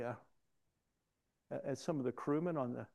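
ordinary talk

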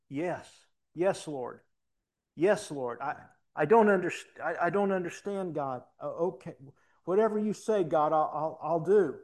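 retelling a father's tearful prayer at full volume; agitated loud prayer. Retold or imitated. Father crying out to God in the living room, loud and agitated. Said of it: "He was agitated".

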